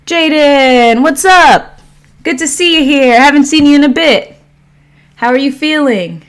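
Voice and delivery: loud, high-pitched welcome; excited greeting. Real, not acted. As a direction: call out to a returning viewer with delight. Greets an arriving livestream viewer.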